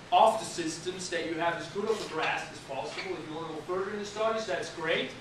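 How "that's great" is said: usual speaking voice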